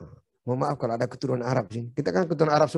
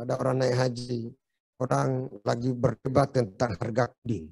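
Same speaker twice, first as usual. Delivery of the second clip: ordinary talk, nothing marked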